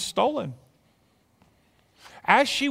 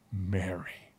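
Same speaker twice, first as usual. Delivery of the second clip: much softer and lower, barely breathed; hushed tender name; 10 semitones lower, 13 dB quieter than usual. Acted. Jesus speaks Mary's name at the tomb, hushed. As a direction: say her name like a secret.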